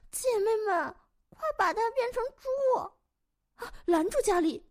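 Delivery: high, loud rallying cry; excited high-pitched witch uproar; shrieked alarm. Acted. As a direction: call the sisters to stop her.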